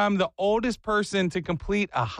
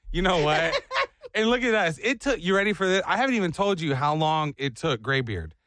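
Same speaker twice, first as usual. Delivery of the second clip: ordinary delivery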